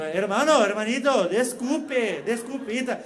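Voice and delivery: hammed-up Brazilian attempt at a Spanish accent; mock Spanish. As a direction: apologise in singsong fake Spanish. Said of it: "Eu já meto um".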